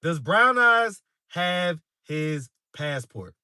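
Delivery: slow right down, separating each word; slow, deliberate. Real, not acted. Streamer putting the question to viewers again, slow and deliberate.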